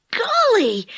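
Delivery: high-pitched astonished exclamation; surprised. A narrator's reading. Boy seeing a walking bed, high and astonished. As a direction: exclaim it in amazement, pitch leaping up. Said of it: "said Dick in surprise".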